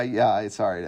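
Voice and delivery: ordinary talk, nothing marked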